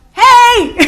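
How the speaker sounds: sharp high loud call; shout. Retold or imitated. Massage lady yelling at the praying client, loud high call. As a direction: grab her attention from afar. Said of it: "she's like".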